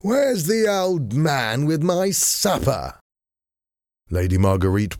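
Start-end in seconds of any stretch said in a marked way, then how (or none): 0.0-3.0 high, slow foppish gentleman calling out; a lightly raised, drawn-out demand for supper; loudly. A narrator's reading. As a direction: call for your supper like an idle lord. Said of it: "said loudly".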